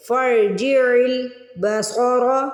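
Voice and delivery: فرجع البصر with fa joined to a heavy ra, very slow; slow Quranic recitation; slow tajweed recitation. Shown as a demonstration. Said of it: "فا کو را سے ملائیں گے".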